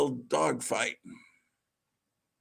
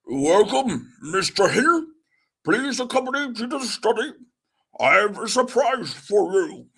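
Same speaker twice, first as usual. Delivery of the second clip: louder, higher put-on Churchill; 5 semitones higher, 3 dB louder than usual. Shown as a demonstration. Churchill welcoming Daredevil, put-on statesman voice. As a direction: play Churchill greeting his guest. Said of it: "How do I do a Churchill voice?".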